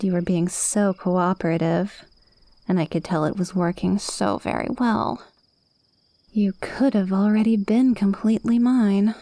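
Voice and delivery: ordinary talk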